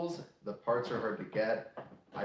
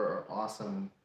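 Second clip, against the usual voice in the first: usual speaking voice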